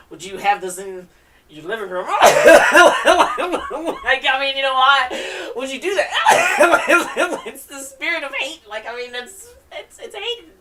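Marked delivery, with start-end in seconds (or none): 2.1-4.0 high loud real laugh, long burst; big laugh. Real, not acted. Reader laughs at keeping a hate spirit at home, loud and long. 4.1-10.5 can't believe it, laughing hard; laughing disbelief. Real, not acted. Reader mocks keeping a hate spirit at home, laughing loudly.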